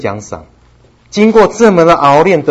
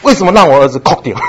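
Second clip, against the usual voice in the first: mock outraged parent, louder and fast; indignant parent; 4 semitones higher, 7 dB louder, faster than usual. Parent slams the table over the crying son, mock outrage. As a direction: bang the table and demand an answer. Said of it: "拍桌子一下".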